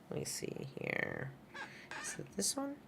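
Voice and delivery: very soft, half-voiced mumble; muttering to self. Real, not acted. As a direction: think aloud under your breath. Talking to self while searching screen.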